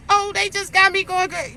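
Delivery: high, sobbing mock wail; mock ugly cry. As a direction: ham up a crying fit over a cheater. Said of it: "The real cry".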